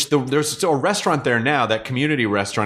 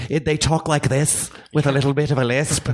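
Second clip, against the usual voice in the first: a light, clumsy mock Irish accent with a little lisp; tentative Irish accent impression, played for laughs; 4 semitones higher than usual. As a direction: toss off a half-hearted Irish lilt, lisping a bit. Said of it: "me going".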